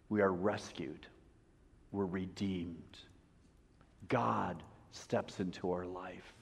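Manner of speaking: soft, low, unhurried preaching; quiet, prayerful delivery with long pauses; hushed closing blessing. Real, not acted. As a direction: bring it down to tender reassurance. Closes sermon with hushed assurance.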